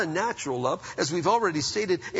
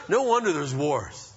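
normal talking voice

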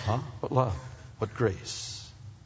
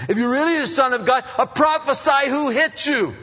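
high, loud mocking challenge; jeering shout; 14 semitones higher, 8 dB louder than usual. Soldiers jeer at blindfolded Jesus, shouting high and loud. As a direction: bark the taunt like a jeering soldier. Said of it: "They slapped him and they spit on him".

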